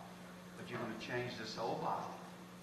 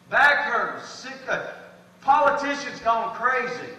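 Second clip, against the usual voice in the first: loud, high preaching from the pulpit; full-force preaching; 9 semitones higher, 12 dB louder than usual. Acted. Pastor urging the church to count blessings, full-force preaching. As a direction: pastor preaching hard to the congregation.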